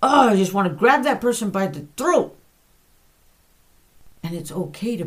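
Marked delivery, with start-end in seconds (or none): 0.0-2.4 loud, fast, higher exasperated venting; fed-up outburst. Retold or imitated. Preacher retelling his angry prayer to God, loud and fast. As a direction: blurt it out like you're at the end of your rope. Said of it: "And I've even told God".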